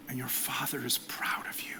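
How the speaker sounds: tender hushed whisper; soft whispered blessing to fathers. Real, not acted.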